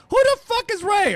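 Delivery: high, loud, baffled mock yell; mock outburst. DJ mocking his own reaction to a fan named Ray, loud and high. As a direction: blurt it up high like you're baffled.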